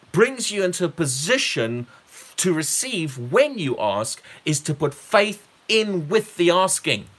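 ordinary talk, nothing marked

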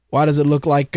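usual speaking voice

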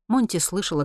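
plain reading voice

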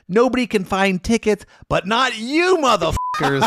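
rally the room like a hype man; hyped crowd speech. Retold or imitated. Retold speech to the theater crowd.

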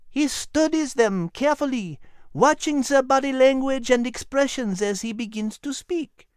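high, small dog voice with a French touch; a tiny French dog explaining; high chatty dog voice; little French dog. A narrator's reading. Liz explains.

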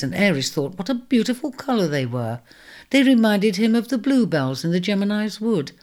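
usual speaking voice

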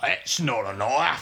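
slow mock Australian; playful Aussie impression; mock Australian accent. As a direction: drawl it like an Aussie bushman. Repeats the Aussie knife joke.

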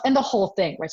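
ordinary talk, nothing marked

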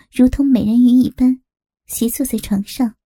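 usual speaking voice

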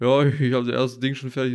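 proud answer. Retold or imitated. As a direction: announce it proudly. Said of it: "Und ich so".

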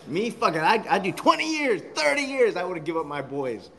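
ordinary talk

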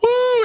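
high loud joyful whoop; preacher's whoop. Real, not acted. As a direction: let out a big church woo. Preacher's exclamation.

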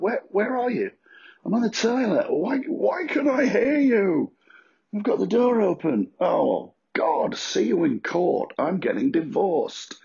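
very high, hammed-up indignation; mock outraged exchange. Acting out a horrified partner hearing the toilet, very high. Acted toilet phone quarrel.

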